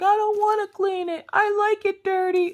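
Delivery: very high, mock whiny kid voice; whining child. Daughter refuses to clean her room, high mock whine. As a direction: imitate a kid whining in protest. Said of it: "she spends all day upstairs whining".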